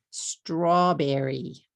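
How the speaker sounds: "Strawberry" slowly, in three beats straw-be-rry; three-syllable English. Shown as a demonstration. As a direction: stretch "Strawberry" with str kept as one cluster. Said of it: "How about this word?".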